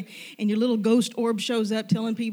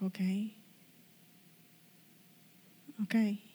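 subdued assent; 7 dB quieter than usual. Retold or imitated. Retelling hesitant assent to the Lord.

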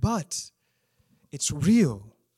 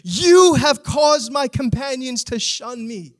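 loud, high shaking-fist cry to God; preacher acting out a despairing psalmist's anguished shout; anguished protest; 5 semitones higher, 6 dB louder than usual. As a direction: hurl the psalm at heaven in protest. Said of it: "Do you hear him?".

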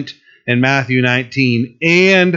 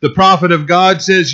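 usual speaking voice

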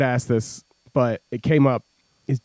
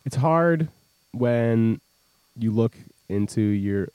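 slow, soft, heavy with meaning; mock deep sincerity; 7 semitones lower, 6 dB quieter than usual. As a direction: play it like a solemn heart-to-heart. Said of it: "It's deep".

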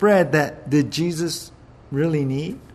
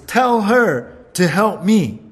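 bossy order; 5 semitones higher, 5 dB louder, slower than usual. Retold or imitated. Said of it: "Martha says".

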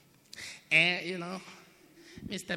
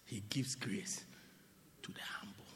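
whispered, much softer; 11 semitones lower, 17 dB quieter than usual. Real, not acted. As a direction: lean in and whisper it. Preacher drops to whisper.

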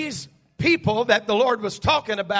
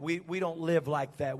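We, we don't live like that said much softer and lower, mock-prim; mocking soft coax; 7 semitones lower, 12 dB quieter than usual. The soothing woman mocking the strict voices to Noah, soft. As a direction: murmur it as a dismissive imitation. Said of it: "there comes this woman along that says".

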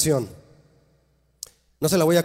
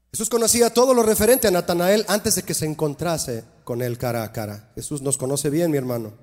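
ordinary talk, nothing marked

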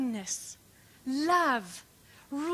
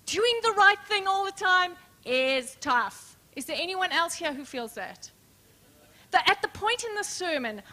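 normal talking voice

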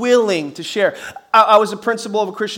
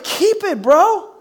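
offended refusal; 5 semitones higher than usual. Retold or imitated. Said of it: "You're like".

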